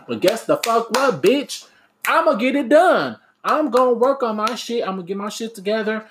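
high-pitched, defiant declaration; fired-up defiance. Real, not acted. Speaker answering those who don't believe, fired-up defiance.

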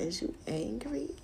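near-whispered mimicry of him teasing; hushed, whispery mock question; soft taunting imitation. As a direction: mimic him very softly, needling. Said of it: "I ain't never seen he talk like".

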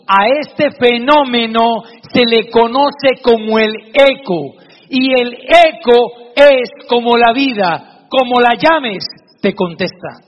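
preacher closing with the moral; preaching the moral. Acted. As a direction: drive the lesson home to the audience. Moral of the sermon story.

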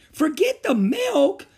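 high, loud, slow alarmed cry; teacher crying out to the nurse. Acted. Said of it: "she cried".

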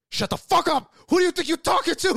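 imitated screaming outburst, very high and loud; acted-out furious shriek; outraged mom. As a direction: explode like a mother who's been disrespected. Retells mom exploding at daughter.

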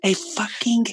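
put-on high, slow, laughing child yell; retelling son's outburst, laughing mock yell; mock kid yell. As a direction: ham up the little boy's swear. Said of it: "he's like".

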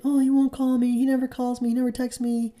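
high put-on voice imitating a complaining woman; mock whiny woman. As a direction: send up a woman moaning he never calls. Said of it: "she's crying about it".

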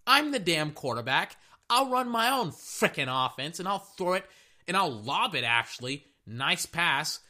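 high, brash talk-back; high cocky shut up; cocky quarterback voice. Retold or imitated. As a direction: play the QB snapping back at the fan. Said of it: "Lamar Jackson is like".

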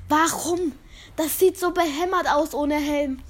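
loud, exasperated why; frustrated shout. Real, not acted. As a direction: yell it out in disbelief. Frustrated after dying in game.

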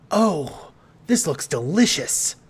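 eager delight. A narrator's reading. Marcy Marcy expecting a meal, eagerly delighted. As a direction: gush over the food.

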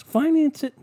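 high, loud shout of finance; mock excited. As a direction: cheer the bad idea. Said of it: "these fucking people are like".

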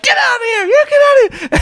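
very high falsetto screech; mock irate manager. Manager orders man out after chicken grab, mock irate screech. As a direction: imitate a manager shrieking him out. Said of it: "then she's like".

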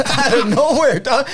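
high, laughing through the words. Real, not acted. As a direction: giggle it out as you begin the story. Laughing while starting the story.

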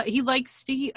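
ordinary talk

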